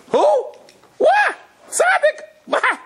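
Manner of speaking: sudden high squeaky disbelief; retold shocked cry of who, what; shocked outburst. Guest shocked at the rabbi's claim.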